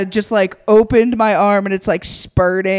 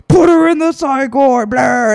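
loud, higher mock demand; mocking mimicry; 4 semitones higher, 4 dB louder than usual. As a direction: shout it like a caricatured opponent. Mimicking the opposition's demand in court.

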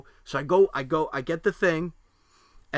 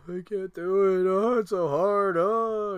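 high, slow whining wail; drawn-out mock sob, pitched high; mock crying; 6 semitones higher, 4 dB louder, slower than usual. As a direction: imitate an overwhelmed kid wailing. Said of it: "he's crying".

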